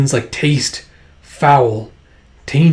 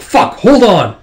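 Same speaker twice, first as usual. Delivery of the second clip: loud, higher cry after the bite; pained shout; 7 semitones higher, 5 dB louder, slower than usual. Acted. Warlord bitten by an attacker, pained shout. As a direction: yell through the pain. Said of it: "He's like".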